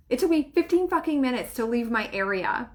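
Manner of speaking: ordinary talk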